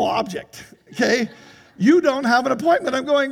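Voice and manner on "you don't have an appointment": insistent clerk. Retold or imitated. Clerk quoted again, insisting.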